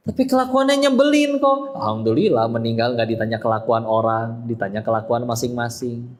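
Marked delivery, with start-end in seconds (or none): 0.1-1.8 high, whiny-style mock complaint; acted high-pitched protest; objector's voice. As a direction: play someone grumbling back, pitched far up.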